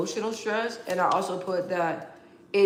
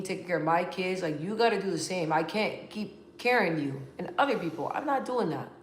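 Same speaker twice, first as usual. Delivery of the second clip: normal talking voice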